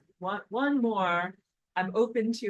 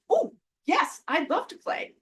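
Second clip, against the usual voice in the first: high, bright-eyed eager acceptance; eager yes; 9 semitones higher, 4 dB louder than usual. Retold or imitated. Neighbours invite her to Bingo, a high eager yes. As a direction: jump at the invitation with delight. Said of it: "And I'm like".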